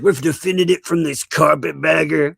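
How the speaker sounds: high put-on voice, mocking the defenders; mocking imitation. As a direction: mimic them, pitched well up. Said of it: "I love it when they mock Southerners".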